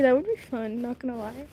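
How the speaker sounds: plain reading voice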